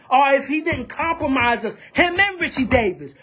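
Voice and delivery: usual speaking voice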